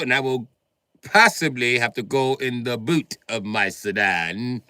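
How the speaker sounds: loud, high British menace, drawn out; British threat. Acted. British gunman warning a man to shut up, loud British accent. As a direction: threaten him with overdone British formality.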